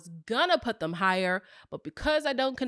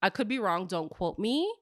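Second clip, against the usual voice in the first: ordinary delivery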